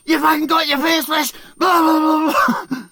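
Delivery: high loud cheer; mock shouted praise. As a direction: yell it out proudly. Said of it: "he's like".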